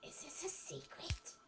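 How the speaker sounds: slow curious whisper. Real, not acted. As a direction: whisper it like a secret. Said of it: "Why are we whispering?".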